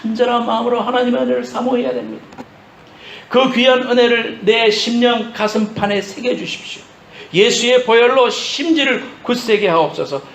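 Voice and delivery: high, pleading prayer from the pulpit; impassioned preaching. Real, not acted. Preacher modelling a prayer for grace, impassioned preaching. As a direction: plead earnestly, pitch held high.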